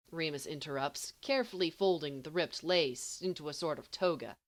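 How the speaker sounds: ordinary delivery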